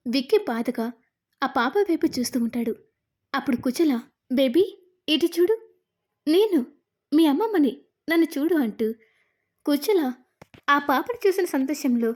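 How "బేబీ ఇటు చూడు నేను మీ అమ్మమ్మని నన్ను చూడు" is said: loud happy calling. A narrator's reading. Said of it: "గట్టి గట్టిగా అరుస్తూ".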